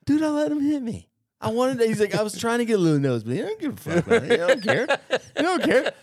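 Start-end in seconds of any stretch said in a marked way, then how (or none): 0.0-1.9 high, indignant excuse in mock voice; [name]. Buddy claims he let the lieutenant hit him, high-pitched imitation. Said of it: "like, higher pitch". 2.1-5.9 high, hurried, mock-defiant excuse; [name]. Buddy makes excuses for his nosebleed, high-pitched imitation. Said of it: "like, higher pitch".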